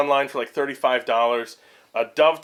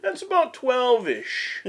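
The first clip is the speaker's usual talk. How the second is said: drawn-out joke; 12 semitones higher, much slower than usual. Pretend. Joking about ish.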